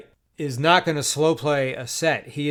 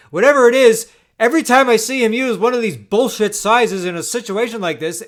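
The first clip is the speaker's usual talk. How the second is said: loud, high, fired-up complaining; heated rant; 6 semitones higher, 6 dB louder than usual. Real, not acted. Venting to viewers about an opponent's lucky bets, heated and loud. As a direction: vent the frustration hard.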